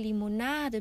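ordinary delivery, nothing marked

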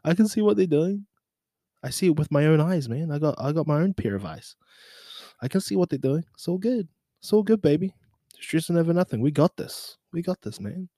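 high-pitched mock chill voice; mock reassurance. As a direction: brush off rivals in a high playful voice. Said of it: "It's like".

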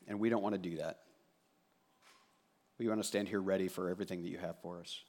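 soft, hushed prayer voice. Acted. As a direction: pray it quietly and humbly. Pastor prays before reading.

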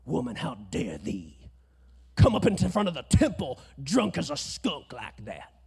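preacher voice. Retold or imitated. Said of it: "his preacher voice comes out".

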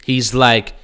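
usual speaking voice

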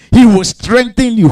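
normal talking voice

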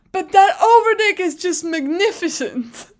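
high, loud theatrical rave; dramatic gushing. Retold or imitated. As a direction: declaim it like an overwhelmed superfan. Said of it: "I'm dramatic".

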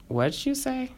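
high, hushed mock yell. A narrator's reading. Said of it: "Destiny yelled".

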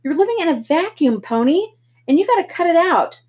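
big brother shouting at Pony; angry older brother. Acted. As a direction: lay down the law, furious. Darry yells back in argument.

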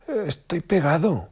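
very high, loud whine; whiny complaint. A narrator's reading. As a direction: complain like a stuck fox. Fox stuck, complaining.

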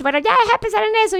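a husband cutting off her worrying, quick and high; mimicking David's brush-off. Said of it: "Me dice".

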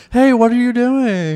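high, slow coy greeting; flirty late-night voice. Retold or imitated. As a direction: pitch up and coo it. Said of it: "that's the voice I got".

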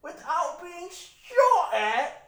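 high, drawn-out British caricature; mock British accent. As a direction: taunt slowly as a squeaky cartoon Brit. Mock British comeback after bashing Brits.

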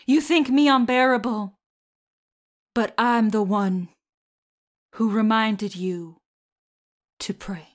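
a hint of a mild southern accent, dramatic; Carrie's mom, southern accent. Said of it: "with the accent".

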